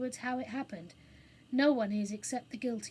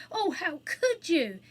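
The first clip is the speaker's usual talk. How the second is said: high, loud, drawn-out reproach; dismayed reproach; 6 semitones higher, 7 dB louder, slower than usual. A narrator's reading. Jane blaming the Phoenix for the fire, dismayed. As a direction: cry out in dismay at the culprit.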